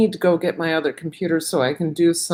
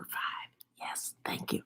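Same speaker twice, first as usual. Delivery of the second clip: whispering; 20 dB quieter than usual. Real, not acted. Whispered aside on a livestream.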